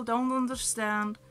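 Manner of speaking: usual speaking voice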